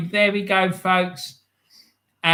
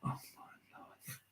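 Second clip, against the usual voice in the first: breathless whispered "oh my life" in disbelief; real shocked whisper; stunned whisper; 25 dB quieter, slower than usual. Real, not acted. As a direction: drop to a hushed whisper, slow and stunned. Shocked by Arsenal's loss.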